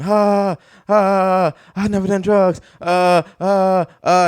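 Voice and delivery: high-pitched imitation of labour moans; hammed-up groaning through contractions; mock labour groans. Comic imitates her in the delivery room, mock labour groans.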